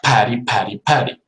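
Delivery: Patty with a strong puff on the P; explosive P. Shown as a demonstration. Teacher drilling the English P. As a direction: burst the P in Patty. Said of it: "both of my lips become ready for the P".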